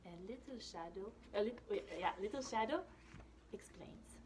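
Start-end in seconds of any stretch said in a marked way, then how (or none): none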